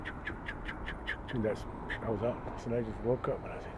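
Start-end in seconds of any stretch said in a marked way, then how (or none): 0.0-1.4 breathy voiceless choo sounds, very soft; whispered imitated pulse. Recalling what he saw in the hospital, whispered imitation. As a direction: mimic the machine's pulse under your breath. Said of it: "I just seen him going".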